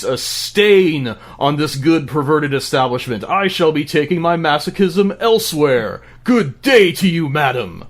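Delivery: loud, high theatrical outrage; mock fury. A spurned client storming out on his dominatrix, furious. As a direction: declaim it indignantly, pitch riding high. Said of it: "with a fury and says".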